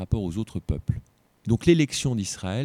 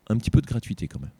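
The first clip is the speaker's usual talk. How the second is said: ordinary talk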